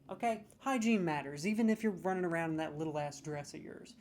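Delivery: ordinary delivery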